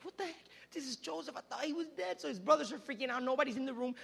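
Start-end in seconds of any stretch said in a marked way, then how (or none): 0.0-2.2 high, hushed disbelief; dumbfounded. Acted. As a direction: gasp it out softly, acting stunned. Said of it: "They were dumbfounded before him".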